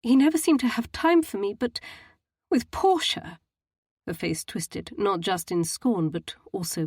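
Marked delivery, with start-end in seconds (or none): 0.0-3.4 a touch of tearful daughter. A narrator's reading. Matilda voiced in novel dialogue.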